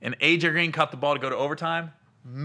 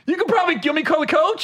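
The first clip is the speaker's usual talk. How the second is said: excited; 12 semitones higher, 3 dB louder, faster than usual. Real, not acted. Teasing friend, overexcited.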